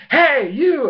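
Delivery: loud, high "hey" hurled at someone; indignant call. Retold or imitated. Acted angry challenge to Ursaring.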